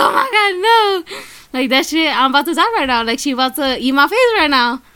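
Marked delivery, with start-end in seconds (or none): 0.1-1.2 much higher, whimpering cry of dread; half-joking panicked wail; mock terror. Retelling sitting behind the creepy woman, a mock-terrified wail.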